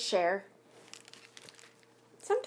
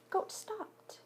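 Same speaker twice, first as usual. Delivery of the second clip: hushed; 11 semitones higher, 9 dB quieter than usual. A narrator's reading. Dramatic pause in story reading.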